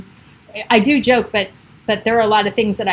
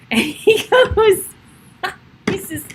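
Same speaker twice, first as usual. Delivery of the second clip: helpless giggle through the words, higher and slow; laughing; 10 semitones higher, slower than usual. Real, not acted. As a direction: crack up before the punchline. Laughing before her own punchline.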